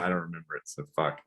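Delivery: ordinary talk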